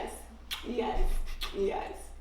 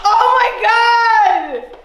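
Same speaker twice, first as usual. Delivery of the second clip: loud, high, drawn-out exclamation; real overwhelmed outburst; thrilled amazement; 5 semitones higher, 10 dB louder, much slower than usual. Real, not acted. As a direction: stretch it into a joyful shout. Reacting to the proposal.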